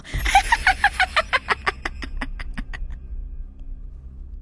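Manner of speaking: high breathless demonic laugh; eerie laugh. Acted. Possessed boy laughing at his mother, high eerie cackle. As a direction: burst into a thin possessed cackle. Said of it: "Rồi nó cười phá lên".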